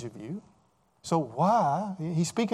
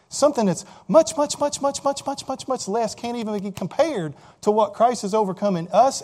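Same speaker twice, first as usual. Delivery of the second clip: rising preacher's emphasis; 5 semitones higher, 4 dB louder than usual. Real, not acted. Preacher piling up repeated much.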